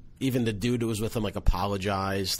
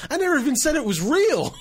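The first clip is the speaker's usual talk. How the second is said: put-on much higher and louder, outraged girl yelling back; shrieking comeback; 6 dB louder than usual. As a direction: fire it back at the woman, screeching. Girl stands up shouting back.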